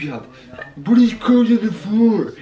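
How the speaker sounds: high, loud imitation of scolding; mock yelling. Mimicking the partner who woke the sleepers, mock yell. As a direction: mimic a noisy complaint. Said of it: "And that's what woke them up".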